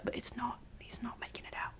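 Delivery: ominous whispered aside. Acted. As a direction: whisper the spoiler to the listener. Ominous aside about Icarus's fate.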